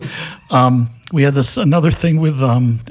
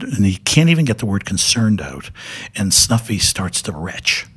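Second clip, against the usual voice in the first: normal talking voice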